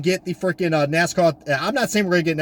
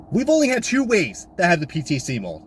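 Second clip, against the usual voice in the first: usual speaking voice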